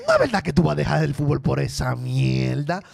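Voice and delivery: hot-headed Dominican dad voice; Dominican accent. Retold or imitated. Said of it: "He's like".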